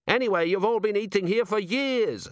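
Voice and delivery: high-pitched, protesting character voice; defensive dwarf cook. A narrator's reading. Cook appeals to his regulars, defensive. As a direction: win the crowd back, pitched high.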